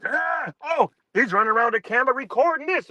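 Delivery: high, fast mocking heckler's cry. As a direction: ape a heckler yelling about the camera. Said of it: "Sitting there yelling out".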